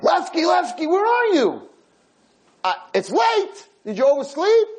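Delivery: put-on old man calling his friend across the nursing home room. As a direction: call out for a friend who doesn't answer. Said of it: "he starts screaming across the room".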